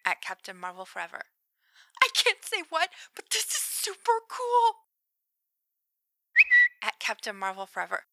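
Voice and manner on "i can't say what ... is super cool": excited. Acted. Excited texting.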